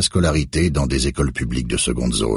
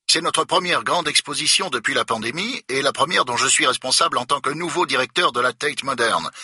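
stiff, outraged official; indignant museum director; 12 semitones higher than usual. A narrator's reading. Director blames Becker's incompetence, indignant and prim.

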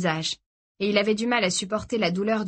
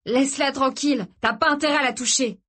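raised, protective warning; angry warning; 6 semitones higher than usual. A narrator's reading. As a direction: warn him off, pitched up. Frère défend sa sœur. (avertissement furieux)

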